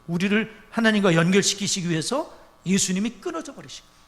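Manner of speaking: normal talking voice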